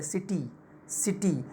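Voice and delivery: City with an easy सि and a drawn-out टी; stress on टी. Shown as a demonstration. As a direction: stretch the टी each time in city. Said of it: "टी को हमने खींचा है".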